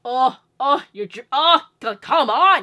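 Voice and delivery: loud, acted exasperation; mock frustrated urging; impatient listener. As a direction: groan at a dragging player. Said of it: "Or they'll feel like".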